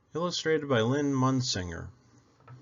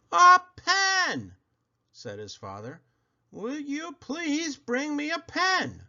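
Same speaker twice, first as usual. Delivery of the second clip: much higher, slower repeat; patient father repeating; slower than usual. Acted. Father repeating his request to Buddy, slower. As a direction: spell it out one more time.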